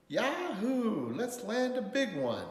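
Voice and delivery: high, loud yahoo whoop; too-loud exclamation. A narrator's reading. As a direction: holler it out with glee. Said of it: "his words came out too loud".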